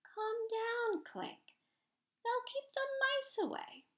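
very high-pitched, soothing artist; calm him down in a high, put-on voice; high voice from the empty seats. A narrator's reading. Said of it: "came a softer voice".

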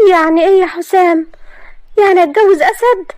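high, loud hurt disbelief; wounded girl questioning her sweetheart; hurt young woman. Acted. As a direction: demand an answer from him. Maria confronts Hossam.

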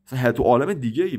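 ordinary delivery

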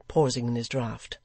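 ordinary delivery

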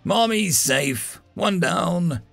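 gruff, drawn-out tallying; grumbling. A narrator's reading. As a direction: tick off a chore grumpily. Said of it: "the voice grumbled".

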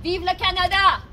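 loud ceremonial cheer; rousing proclamation. Acted. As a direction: ring out the royal toast.

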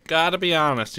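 ordinary delivery

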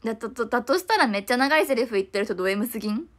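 usual speaking voice

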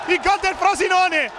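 high but easing second call; repeated goal call. Real, not acted.

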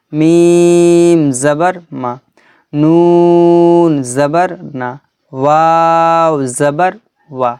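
letter name held long and level, last syllable short; slow, measured spelling chant; Quran primer recitation. Shown as a demonstration. Teacher models Arabic letters for students, drawn-out recitation.

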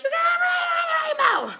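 high thrilled yell; calling to the family about the rainbow; excited shout. Retold or imitated. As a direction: shout it to the back seat. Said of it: "And I'm like".

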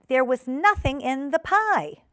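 high, slow, stern denial; severe. A narrator's reading. Ribby correcting the wailing Duchess, severe. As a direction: say it severely, spacing the words. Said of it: "said Ribby severely".

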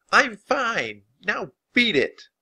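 high, indignant yell; scrappy high-voiced telling-off; feisty defiance. A narrator's reading. Annie yells at the vulture.

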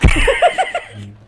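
sudden high, loud laugh; high, loud real laugh; burst of laughter. Real, not acted. Laughs at the clip line.

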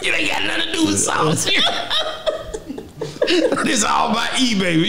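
high, laughing, half-shouted brag; a real laughing boast, voice going high. Real, not acted. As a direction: crack up while bragging.

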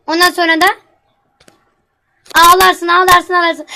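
loud, scolding mother; angry threat. Acted. Mother threatening her crying daughter, loud scolding. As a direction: lay down the law, furious.

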